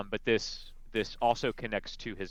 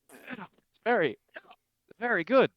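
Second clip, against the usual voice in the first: higher put-on voice, slowed, forced politeness; covering bad tea; 11 semitones higher, 8 dB louder than usual. Glamdry pretending River's stale tea tastes good. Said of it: "I lost my breath just trying to run over here".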